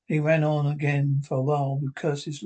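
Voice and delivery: plain reading voice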